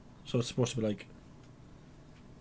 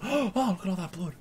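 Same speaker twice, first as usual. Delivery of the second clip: gasp, then higher mock horror; mock shocked onlookers; 7 semitones higher than usual. Podcaster imitating people shocked by blood, mock horror. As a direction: play a startled crowd with a gasp.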